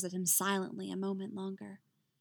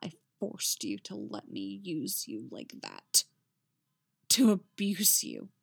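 low bitter confession, hardening at the end; dark self-accusing bitterness; 3 semitones lower than usual. A narrator's reading. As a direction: confess it low, then hit the last words. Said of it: "bitterness thick in his voice".

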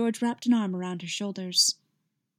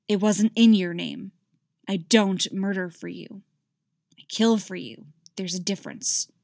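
ordinary delivery

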